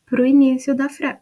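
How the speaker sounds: usual speaking voice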